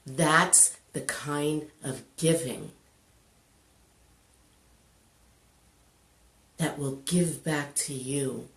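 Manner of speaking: soft, slow, with long weighted pauses; solemn inspirational close. Real, not acted. Storyteller drawing the lesson about giving, hushed solemn delivery. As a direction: land each phrase gently and let it rest. Closing moral after the story.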